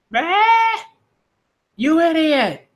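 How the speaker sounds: high startled yelp then scolding; shocked outburst. Acted. Luffy shocked by devil fruit.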